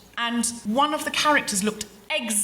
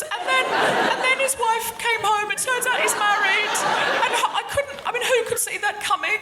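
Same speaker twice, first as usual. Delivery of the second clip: much higher, laughing confession voice; giddy friend impression; 9 semitones higher than usual. A friend rings up confessing a night at Jeffrey's, high and giggly. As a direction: gush it out like a sheepish friend on the phone. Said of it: "our friend can ring us up and go".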